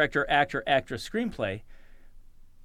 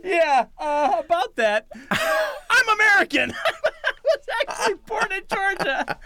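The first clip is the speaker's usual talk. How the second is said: put-on high, laughing voice of a sheepish girl; laughing confession; 4 dB louder, slower than usual. Imitating a young actress confessing while laughing. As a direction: giggle through the confession.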